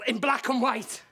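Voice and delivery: usual speaking voice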